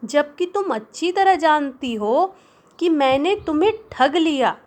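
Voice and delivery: high, tripping angry accusation; angry stammer. Acted. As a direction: stumble over the words in anger. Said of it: "अपनी आवाज़ को clear नहीं कह पा रहा है".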